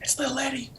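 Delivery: soft, voiceless mouthed words; mouthed whisper. Retold or imitated. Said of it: "she kinda mouths".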